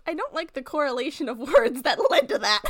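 quick words breaking into laughter; amused, laugh bubbling through. Real, not acted. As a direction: try to object while cracking up. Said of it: "I'm laughing 'cause I'm reading it".

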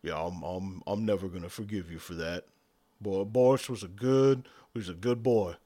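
low, hushed mock grief; mock mournful. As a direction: lament the lost orc. GM mourning Boris mock-gravely.

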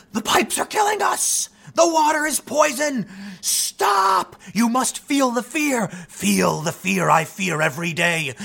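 high, slow, hysterical shouting; a mock crazed protester yelling doom; screaming. A protester with a sign screaming at passersby in town center, hysterical.

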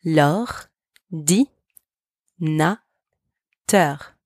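very slow, syllable by syllable; slow teaching model of L'ordinateur; slow syllable demo. Shown as a demonstration. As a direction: stretch L'ordinateur out, sound by sound. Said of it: "Repeat after me".

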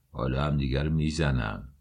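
low-pitched, understated mockery; mocking. A narrator's reading. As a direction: drop the pitch for a mild jeer. (تمسخر ملایم با صدای بم)

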